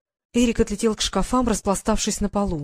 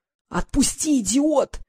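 higher-pitched, strained demand to let go; wheezing; 6 semitones higher, slower than usual. A narrator's reading. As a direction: choke it out while pinned down. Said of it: "хрипел Норт".

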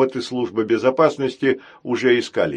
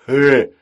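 loud rumbling "хе"; rumbling huff; 3 semitones higher, 8 dB louder than usual. Acted. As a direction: boom a short laugh. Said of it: "пророкотал Грохотун". (раскатистое «хе»)

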